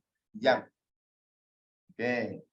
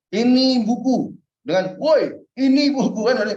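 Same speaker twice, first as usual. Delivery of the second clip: attention-getting call; 6 semitones higher, 7 dB louder than usual. Shown as a demonstration. Teacher calling out to grab the class's attention. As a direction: call out to make people focus. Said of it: "contohnya kan gitu kan".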